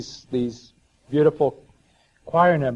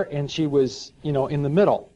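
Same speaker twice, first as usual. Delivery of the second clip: normal talking voice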